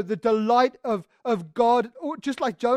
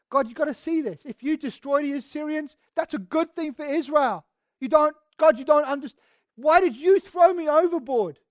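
higher, indignant plea building louder; self-righteous protest; 4 semitones higher, 4 dB louder than usual. Acted. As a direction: protest your innocence, growing more forceful. Acting Jonah's self-righteous protest to God.

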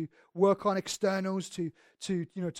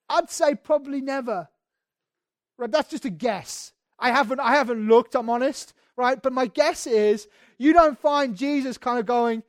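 louder, higher passionate sermon; fervent preaching; 5 semitones higher, 10 dB louder than usual. Acted. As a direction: preach it out, full conviction. Preaching at full force.

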